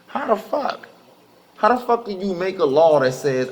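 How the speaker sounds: high, cracking cry of outrage; outraged disbelief. Real, not acted. Ranting to the listeners about the law, outraged and high-pitched. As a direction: let the disbelief burst out high.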